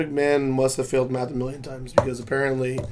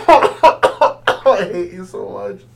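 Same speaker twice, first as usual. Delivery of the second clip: laughing; 5 semitones higher, 11 dB louder, much slower than usual. Real, not acted. Laughing at the bit.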